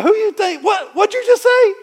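high, loud incredulous shout at his daughter; shocked shout. Retold or imitated. Dad shouting at daughter's Sabbath plan, high disbelieving yell. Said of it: "I'm like".

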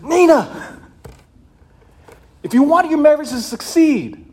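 high, loud fervent exhortation; retold yelling. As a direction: drive the advice hard at the bride. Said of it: "I'm just yelling at these people".

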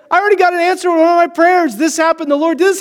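high, fast, thrilled retelling of a friend's news; breathless excited news; excited quote. Retold or imitated. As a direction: gush it out fast and bright-eyed. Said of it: "She's like".

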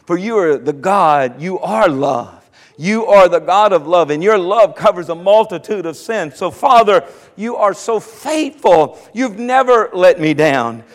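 hushed sample prayer to the Lord; demonstrating prayer. Shown as a demonstration. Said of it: "Things like".

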